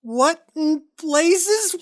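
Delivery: slow, high, stunned disbelief. Acted. Gerald staring at the radio in high, slow disbelief. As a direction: drag it out in shock. Radio knows his secrets.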